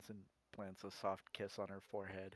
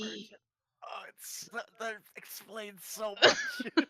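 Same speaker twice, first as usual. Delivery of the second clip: high, loud, slow squeal of amusement; laughing outburst; 12 semitones higher, 11 dB louder, slower than usual. Real, not acted. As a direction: burst out, cracking up at the revelation.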